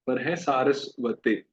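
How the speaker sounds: slow "सारस्वते" with long saa and ending te; correct pronunciation. Shown as a demonstration. Teacher corrects सरस्वती to "सारस्वते", slowly. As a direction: stretch saa and end "सारस्वते" on te. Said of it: "कहिए सारस्वते".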